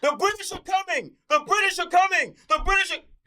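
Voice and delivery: calling out high, a hammed-up alarm cry; mock town crier. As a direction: shout it like a colonial rider warning the town. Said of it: "calling out like Paul Revere".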